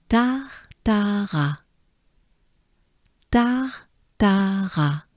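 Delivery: tartara drawn out slowly, each syllable held. Shown as a demonstration. Teacher slowly models tartara for students. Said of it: "But it ends with a".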